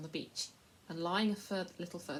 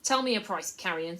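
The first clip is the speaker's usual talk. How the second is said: louder, cold command; stern lord; 9 dB louder than usual. A narrator's reading. Lord ordering the slaver to name a price, stern. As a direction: cut him short with contempt. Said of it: "said the lord sternly".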